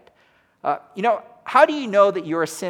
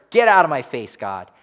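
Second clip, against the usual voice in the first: mock angry retort; 3 semitones lower, 7 dB louder than usual. Cain telling God off.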